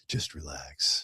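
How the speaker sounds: unvoiced, slow and soft; spa talk voice. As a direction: hush a client to relax. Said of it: "your spa talk voice".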